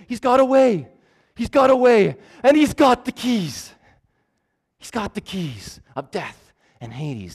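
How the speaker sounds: high, loud panicked devil's cry; Satan's voice. Retold or imitated. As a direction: cry out like a frantic Satan losing his prisoner. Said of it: "in the voice of Satan".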